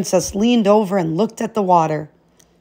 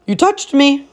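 higher, louder gleeful frog croak; 7 semitones higher, 4 dB louder than usual. A narrator's reading. Frog gloating to the princess, gleeful croak. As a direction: crow it like a frog who just won. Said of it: "croaked the frog gleefully".

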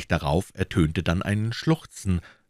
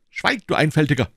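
much higher, louder rebuke; snapped order; 10 semitones higher, 4 dB louder than usual. A narrator's reading. D'Artagnan silencing his fearful servant, sharp. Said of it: "rief D'Artagnan".

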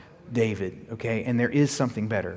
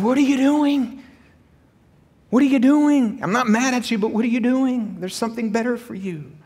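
high, loud repeated question that softens at the end; pleading preaching cry; 11 semitones higher, 5 dB louder than usual. Acted. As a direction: call out to them like God asking why, then ease off. Preacher voices God's question.

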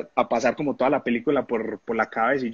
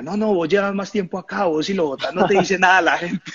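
teammate arguing; 6 semitones higher, 4 dB louder than usual. Retold or imitated. Teammates arguing over penalty.

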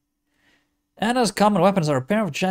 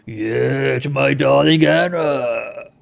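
lower, drawn-out menace; dangerous drop; 3 semitones lower, 4 dB louder, much slower than usual. Acted. Feyrod warning his darling Anna, dangerously dropped voice. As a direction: let the voice drop dangerously, slowly. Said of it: "then his voice drops dangerously".

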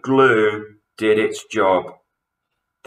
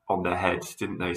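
plain reading voice

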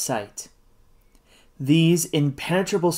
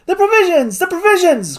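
high, frantic call to the crew; desperate high-pitched order; urgent cry; 13 semitones higher than usual. A narrator's reading. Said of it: "cried the doctor".

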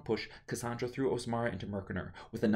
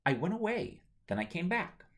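evasive daughter; 10 semitones higher than usual. Acted. Daughter dodging mother's question.

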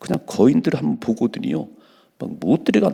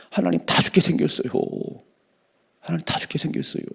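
higher, pleading cry to God; Ezekiel's lament; 7 semitones higher, 3 dB quieter than usual. Retold or imitated. Ezekiel crying out to God as his people are slain, pleading high. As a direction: beg God for mercy, voice raised high. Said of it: "에스겔이 보고 막".

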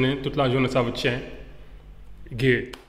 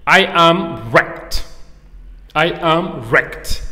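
slow, drawn-out WRECKED, pitched up; emphatic slow model; 4 semitones higher, 5 dB louder, much slower than usual. Shown as a demonstration. Teacher presents phrase five to learners, slow and emphatic. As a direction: stretch each word, strongly stressed.

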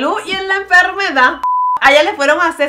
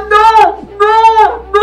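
louder, higher repeated no; alarmed noes; 6 semitones higher, 4 dB louder, much slower than usual. Real, not acted. Vlogger watching stage collapse, alarmed protest.

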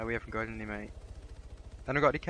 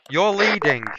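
loud, higher, fed up; exasperated shout; 6 semitones higher, 7 dB louder, slower than usual. Real, not acted. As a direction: snap it out in frustration. Frustrated at squad leader.

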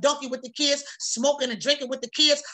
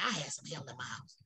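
a sheepish whispered admission; whispered confession; 11 semitones lower, 16 dB quieter than usual. Real, not acted. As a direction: whisper it, lower and far softer. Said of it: "let me recant that".

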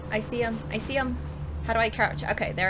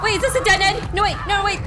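ordinary talk

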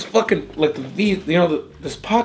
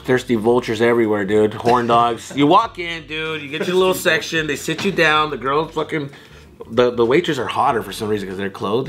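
ordinary talk

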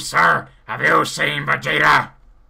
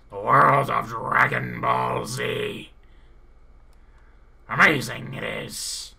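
usual speaking voice

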